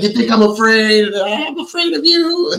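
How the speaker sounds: very high, stretched imitation of panic; high mock wail, drawing out the end; mock frantic yell. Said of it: "yells in Grace's ear".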